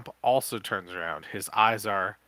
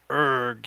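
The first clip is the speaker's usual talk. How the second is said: loud, higher hammed-up zombie groan "Urgh"; zombie moan; 4 semitones higher, 7 dB louder than usual. Hero fakes a zombie moan to fool Crump, hammed-up groan. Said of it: "you moan in your best zombie imitation".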